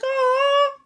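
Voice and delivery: very high, rising squeals of amazement; overwhelmed little high-pitched gasps of awe; awed squeal. Retold or imitated. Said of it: "I was like".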